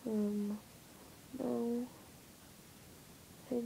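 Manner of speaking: ordinary talk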